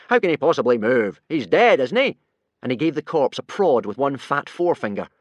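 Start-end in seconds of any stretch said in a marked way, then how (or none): 0.1-2.2 scoffing. A narrator's reading. Gobber scoffs loudly.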